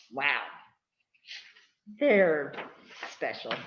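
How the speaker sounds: ordinary delivery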